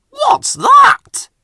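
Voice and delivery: high loud startled shout; called out. A narrator's reading. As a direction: shout it out through the blizzard. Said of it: "his driver called out".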